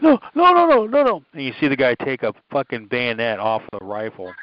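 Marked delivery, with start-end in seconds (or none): 0.0-1.2 very high, pleading no no no; mock pleading. Retelling the victim in the video, in a high mock plea. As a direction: beg for mercy in a high squeal.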